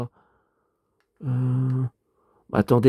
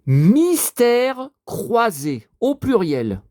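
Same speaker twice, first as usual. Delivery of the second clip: drawn out very slowly, syllable by syllable; slow typing-along dictation, a little higher; 8 semitones higher, much slower than usual. Real, not acted. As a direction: dictate it slowly as you type. Typing the search aloud.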